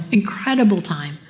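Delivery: ordinary delivery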